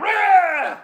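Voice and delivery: screeching angry growl; hammed-up wordless yell of fury; high mock rage scream. Angry Scotty punching the wall, high wordless screech. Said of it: "do an angry Scotty impression".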